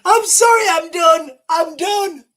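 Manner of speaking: high, loud, almost crying with laughter; hysterical, completely losing it; real helpless laughter. Real, not acted. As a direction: gasp out an apology while in fits. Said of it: "I shouldn't be laughing".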